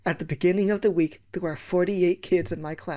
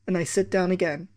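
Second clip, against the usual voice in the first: ordinary delivery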